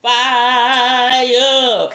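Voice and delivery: loud, long stretched-out word into laughter; laughing exclamation. Real, not acted. Host agrees cinnamon oil burns, hollering with laughter. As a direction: wail it high and loud, cracking up.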